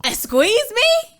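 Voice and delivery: high, loud, drawn-out mock offense; exaggerated, rising affronted question; mock indignation. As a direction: squeal it slowly as if offended. Co-host objects to women remark.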